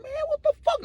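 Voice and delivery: high, squeaky panic, acted out; startled falsetto disbelief; alarmed protest. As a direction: squeal it with a gun at your head. Acts reaction to gun at head.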